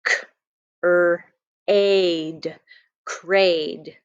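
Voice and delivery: each sound stretched apart, then blended into crade. Shown as a demonstration. Teacher sounds out a nonsense word for young readers, segmenting slowly. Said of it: "So this is the word".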